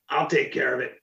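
lightly gruff friend imitation; a hint of a gruff tough guy. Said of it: "in his gruff voice".

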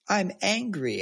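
put-on high, crying toddler wail; imitating an upset daughter's cry; angry toddler cry. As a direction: wail it like a furious little girl. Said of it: "And she said".

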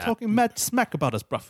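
high mock-English slangy accent; bad English accent. Riffing on offended English listeners, bad English accent. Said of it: "the worst English accents".

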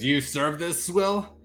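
slow, loud, rising incredulous question; scornful disbelief. Acted. Guard turns on Royce over the bad ale, scornful. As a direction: drag it out high, disgusted.

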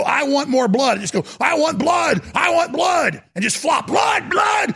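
rising mock frenzy, yelling for blood; ranting madman. Host imitating a dictator raving for blood. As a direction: ham up a raving dictator screaming. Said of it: "flop around on the ground and go".

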